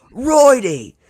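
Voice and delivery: high, loud drawn-out yell; big hammed-up holler; mock yell. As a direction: holler it out across the locker room. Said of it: "see Cage's locker and yell".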